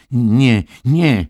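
high, loud, panicked protest; pleading alarm. A narrator's reading. Billy begging the nurse about his mother, alarmed. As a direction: cry out as if begging her to stop. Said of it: "potrząsał błagalnie głową".

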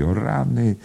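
high-pitched exclamation; crying out. A narrator's reading. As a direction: exclaim in mock dismay. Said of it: "zawołała panna Kennedy".

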